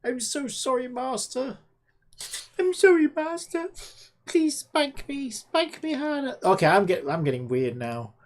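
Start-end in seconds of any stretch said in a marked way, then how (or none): 0.0-1.6 higher, softer simpering plea; a lightly voiced servile chatbot begging; mock groveling AI. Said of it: "A grovel G P T". 2.6-6.4 hammed-up groveling AI. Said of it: "A grovel G P T".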